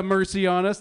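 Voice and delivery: ordinary talk, nothing marked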